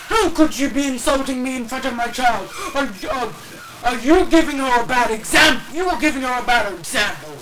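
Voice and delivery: loud entitled parent; entitled mom voice. Acted. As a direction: talk down to her like she's stupid.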